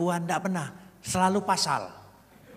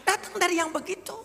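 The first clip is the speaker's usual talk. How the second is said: much higher, earnest sermon voice; preaching; 12 semitones higher, 4 dB louder than usual. Acted. Preacher telling the congregation God works through hardship. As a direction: drive the lesson home. Preacher driving home lesson.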